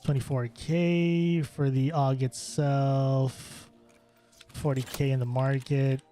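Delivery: ordinary delivery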